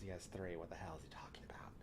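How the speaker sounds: fast breathy whisper under the breath; whispered aside. Real, not acted. Streamer whispering an aside about himself, fast whisper. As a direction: mutter it aside in a quick whisper. Aside mocking his own boast.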